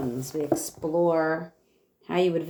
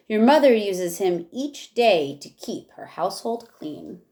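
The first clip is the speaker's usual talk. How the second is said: lively rhyming read-aloud for a class; reading to children; 4 semitones higher, 4 dB louder than usual. Acted. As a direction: read each verse with bounce for the kids. Teacher reads rhyming picture book aloud.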